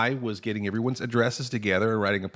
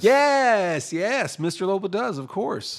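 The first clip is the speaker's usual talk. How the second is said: high excited boss voice; 9 semitones higher, slower than usual. Acted. Skit boss confirms eagerly.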